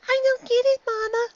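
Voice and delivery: high filly voice, said flatly; a child bluntly not understanding; high little filly, flat and blunt; flat young filly. A narrator's reading. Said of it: "she said flatly".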